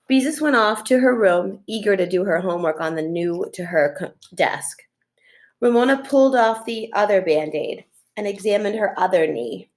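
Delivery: plain reading voice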